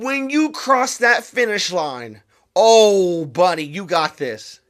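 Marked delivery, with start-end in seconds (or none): none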